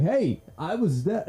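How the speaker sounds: high, drawn-out imitated complaint; mock driver protest. Other driver to comedian in parking structure, mock protest. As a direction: call out indignantly, stretching the Hey. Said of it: "he starts to vocalize to me".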